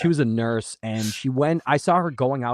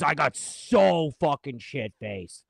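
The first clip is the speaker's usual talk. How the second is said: frat bro; 5 dB louder than usual. Retold or imitated. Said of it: "every fucking loser ... would come up to me".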